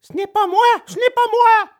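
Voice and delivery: very high tearful denial; acted weepy little puppet; sobbing puppet protest. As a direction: protest in a high wail, climbing on the repeat. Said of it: "s'écria Pinocchio en sanglotant".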